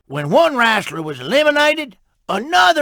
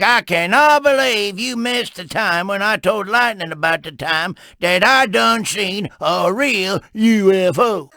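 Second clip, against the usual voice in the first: usual speaking voice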